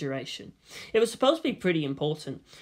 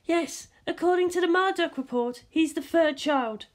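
Misato's voice; 11 semitones higher than usual. A narrator's reading. Misato answering Ritsuko about the boy, high voice.

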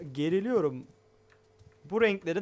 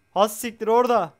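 real frightened yell, higher and louder; startled shout; 7 semitones higher, 6 dB louder than usual. Real, not acted. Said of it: "ben çok bağırıyorum". (korkuyla bağırarak)

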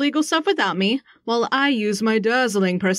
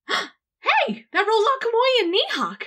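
gasp, then a much higher excited rush; sudden realization; 10 semitones higher than usual. Acted. Realizing to the others who it can't be, gasping and excited. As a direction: it just clicked, blurt it out.

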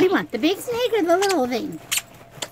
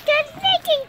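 higher, louder, gushing at the dog; excited pet talk; 9 semitones higher, 3 dB louder than usual. Real, not acted. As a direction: squeal the good news to the puppy. Greeting the dog, baby talk.

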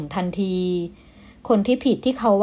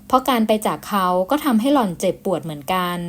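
ordinary talk, nothing marked

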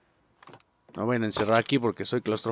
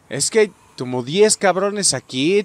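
usual speaking voice

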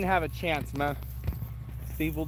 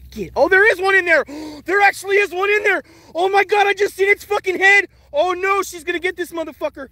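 high, loud and fast, gasping with surprise; excited discovery; 8 semitones higher, 6 dB louder, faster than usual. Real, not acted. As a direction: blurt it out as you spot the animal. Dog finds a mole.

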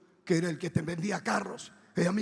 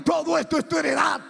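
high, full shout from heaven; voz de trueno; 8 semitones higher, 6 dB louder than usual. Retold or imitated. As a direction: thunder it out at the top of the voice. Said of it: "una voz que retumbaba como trueno". (grito atronador, agudo y fuerte)

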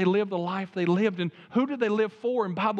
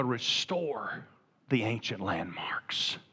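slow, hushed whisper, much lower and softer; whispered charge; 9 semitones lower, 6 dB quieter, slower than usual. Acted. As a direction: breathe the words like a sacred secret. Solemn charge to America.

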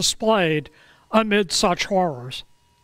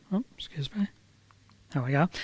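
hushed aside; 6 semitones lower, 9 dB quieter, much faster than usual. Real, not acted. Aside to the mic.

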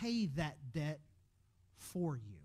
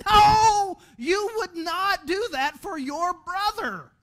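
loud, much higher mocking shout, slowed down; mocking the kid; 11 semitones higher, 14 dB louder, much slower than usual. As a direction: bellow it back to mock the child's answer. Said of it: "I start mocking the kid".

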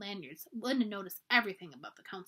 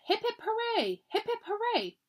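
put-on high cheer of a crowd of girls; 11 semitones higher than usual. A narrator's reading. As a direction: hip hip hooray cheered high. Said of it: "All the campers cheered".

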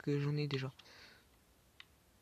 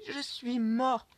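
dépité; 7 semitones higher, 7 dB louder, much slower than usual. Real, not acted. Vient de mourir.

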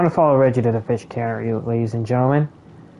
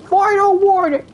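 high, louder mock shout; retold yell; 5 dB louder than usual. Player retelling the character's warning, high mock shout. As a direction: bark it out like the game cop, half in fun. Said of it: "He just yelled".

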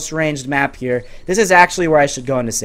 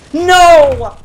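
high, loud "no" of defeat; real dismayed yell; agonized shout; 12 semitones higher, 8 dB louder than usual. Real, not acted. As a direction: cry out as victory slips away. Loses the match.

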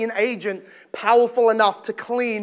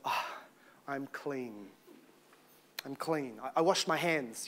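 very soft, low, settling sigh; hushed self-reassurance; 9 semitones lower, 16 dB quieter than usual. Acted. Man telling himself he is clean at bedtime, hushed. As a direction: soothe yourself quietly, low and drowsy.

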